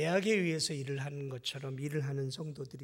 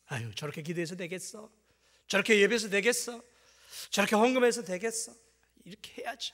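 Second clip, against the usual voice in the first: sneering believer; 4 semitones higher, 6 dB louder than usual. Retold or imitated.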